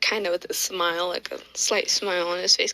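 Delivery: ordinary delivery, nothing marked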